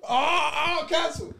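high, loud hammed-up cry; playful scandalised holler; mock cancellation alarm. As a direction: shout it in mock outrage. Mock outrage at racist remark.

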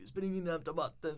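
shouting at the cousins to fetch water, half-voiced; retold call. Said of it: "jag ropar".

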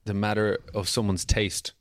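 normal talking voice

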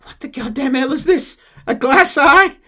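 high, loud indignant yelp; hammed-up disgust at a glass eye; mock outburst. [name] shouting at John over the glass eye.

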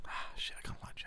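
whispered aside, breathy and hidden. Pretend. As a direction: whisper it so the waiter can't hear.

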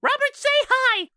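very high witch yelp; high hag hey; Hagatha voice. Acted. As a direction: call out like a squeaky hag. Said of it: "Hagatha's voice going".

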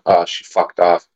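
ordinary talk, nothing marked

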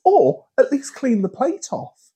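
high-pitched fed-up rant; mock outrage at lazy coworkers; exasperated rant. Ranting about dirty plates.

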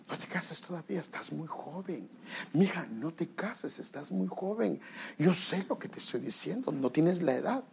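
hushed, gentle counsel; soft parental plea. Acted. Parent telling a child not to marry, soft plea. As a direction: advise softly, well under normal volume.

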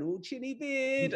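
high, slow mocking "chinny beard"; teasing sing-song. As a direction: tease a lying sibling from behind mum. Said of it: "you're going".

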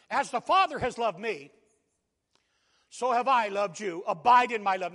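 loud, emphatic reading of the verse; preaching scripture. Acted. Pastor proclaims the verse to the congregation, loud preaching. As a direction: proclaim the words of Jesus. Quoting Jesus in sermon.

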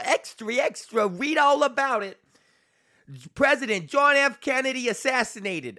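put-on high-pitched old New York newsboy; put-on New York accent. Newsboy crying the headlines to passersby, put-on New York accent. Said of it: "I put on a fucking, uh, New York".

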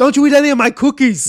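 hammed-up high bellow; loud, high imitation of film fury; mock outraged shout. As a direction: shout it like an over-the-top movie hero. Quotes film character in mock outrage.

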